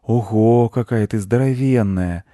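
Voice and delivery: put-on impressed child's exclamation; admiring. A narrator's reading. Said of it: "восхитился он".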